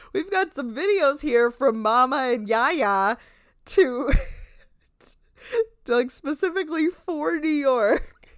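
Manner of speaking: quoting while cracking up; giggling through the words; laughing retelling; laughing through quote. Retold or imitated. Host retells clip, laughing.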